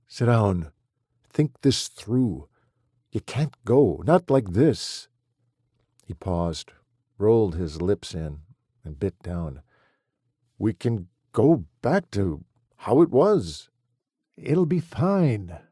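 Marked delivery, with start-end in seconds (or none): 0.1-5.1 pleading. Acted. Husband pleading, wife leaving. 10.6-15.6 begging. Acted. Husband begging her to stay.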